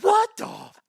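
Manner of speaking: high, loud stunned outburst; loud shocked half-curse; shocked disbelief. Retold or imitated. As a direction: blurt it in total shock. Shocked at walker's news.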